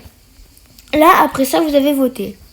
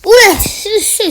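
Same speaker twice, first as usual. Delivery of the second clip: surprised; 9 semitones higher, 6 dB louder, slower than usual. Real, not acted. Surprise at something on screen.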